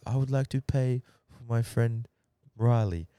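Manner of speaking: a light imitation of Kurt speaking quietly and politely; a hint of a softly spoken, polite mate. Kurt politely paying the stripper for his friend, mild imitation. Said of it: "you know how Kurt talks, like, real, like, quiet and polite".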